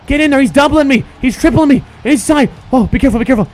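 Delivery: loud, high, pleading yells at the team; frantic urging. Real, not acted. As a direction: shout urgently as the game slips away. Urgent yelling while losing.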